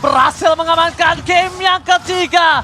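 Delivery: high, slow, shouted win call; victory call. Real, not acted. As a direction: announce the win like a finale. Caster calls the game win. (teriakan kemenangan komentator)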